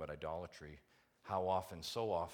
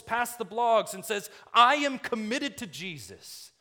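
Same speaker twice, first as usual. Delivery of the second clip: high, loud, impassioned sermon cry; full-force preaching; 11 semitones higher, 12 dB louder than usual. Real, not acted. Preacher urges church to true devotion, full-force preaching. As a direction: drive each phrase home at full volume, drawing out the key words.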